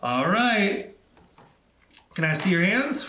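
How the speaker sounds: usual speaking voice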